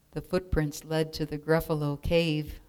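usual speaking voice

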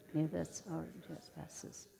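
usual speaking voice

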